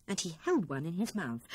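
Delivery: ordinary delivery, nothing marked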